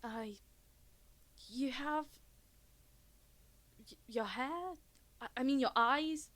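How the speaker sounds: halting, faltering search for words; start, stop and trail off bewildered; confused stammer. Acted. Cannot describe the face before them.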